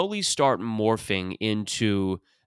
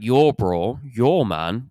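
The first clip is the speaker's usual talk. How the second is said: swooping Toronto vowels; true Toronto accent; 2 semitones higher, 3 dB louder, much slower than usual. Acted. Toronto guy on College Street greeting a friend, Toronto accent. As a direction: stretch "yo" in a mock Toronto accent. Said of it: "The true Toronto accent".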